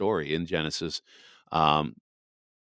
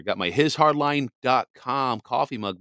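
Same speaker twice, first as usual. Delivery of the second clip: ordinary talk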